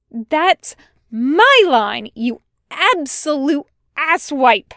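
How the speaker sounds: loud, indignant, drawn-out insult; shouting back. A narrator's reading. Tucker returns Wash's anger, shouting back. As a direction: shout it right back in his face. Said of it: "Tucker shouts back".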